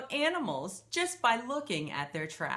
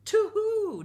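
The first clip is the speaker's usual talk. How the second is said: high, loud hooting owl; 13 semitones higher, 7 dB louder than usual. Acted. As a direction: hoot it out like an owl. Owl answers.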